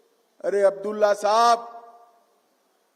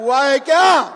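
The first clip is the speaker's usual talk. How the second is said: shouted question; 5 semitones higher, 8 dB louder than usual. Real, not acted. Demanding answer from crowd.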